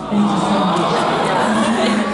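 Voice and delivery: said slowly, each word drawn out; thanks stretched out, word by word. Real, not acted. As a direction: let every word of thanks linger. Repeats thanks to her son.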